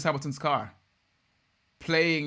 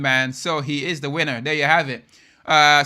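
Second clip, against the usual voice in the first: usual speaking voice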